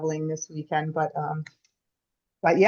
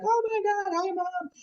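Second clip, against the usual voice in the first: much higher, a delighted squeal to the dolphin; emotional excitement. Retold or imitated. Family at sea greeting the dolphin as Mom, high and thrilled. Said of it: "And we're all like".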